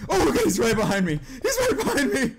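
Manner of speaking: high, half-laughing panic; rising frightened yelps; panicked. Real, not acted. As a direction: squeal with a chaser at your back. Monster right behind player.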